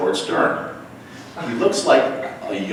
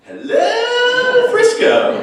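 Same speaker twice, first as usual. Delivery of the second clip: high, loud show-tune belt as a punchline; 5 dB louder, much slower than usual. Retold or imitated. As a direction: belt it out like a Broadway star. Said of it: "and I said".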